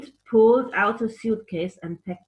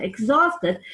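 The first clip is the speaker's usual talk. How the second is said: usual speaking voice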